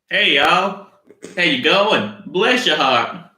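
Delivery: high, loud, badly faked Southern drawl; terrible Southern accent. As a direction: ham up a clumsy Southern US accent. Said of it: "I've got a terrible Southern accent".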